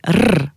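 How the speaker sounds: r trilled on the tongue tip; r as a long trill; rolled R. Shown as a demonstration. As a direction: roll the r, drawn out. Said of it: "un R roulé".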